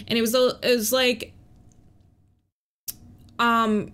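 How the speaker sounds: usual speaking voice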